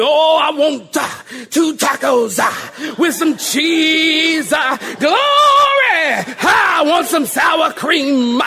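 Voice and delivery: loud, high pulpit shout with "uh" endings; mock preacher voice. Preacher imagines ordering at Taco Bell, in exaggerated pulpit shout. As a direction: order food like a revival preacher, words hugely stretched. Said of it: "do you talk this way when you order".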